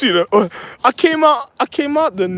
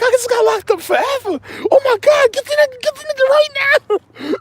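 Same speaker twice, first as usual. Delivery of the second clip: wife in a panic. Retold or imitated. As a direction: squeal out her panic. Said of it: "She was like".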